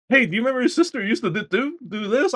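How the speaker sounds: very high, quick imitation; mock game character. Game fragment asking about a sister, high quick mock voice. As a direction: rattle off the dialogue in a high voice. Acting out the next memory fragment.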